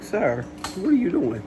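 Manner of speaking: exasperated demand to the delivery guy; irritated retelling; annoyed quote. Retold or imitated. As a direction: scold him like he's late. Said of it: "Y'all, I was pissed".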